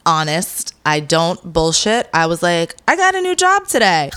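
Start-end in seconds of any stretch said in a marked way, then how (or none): 2.9-4.1 high, loud mock announcement; blurted announcement. Retelling the news she broke to mom, high blurt.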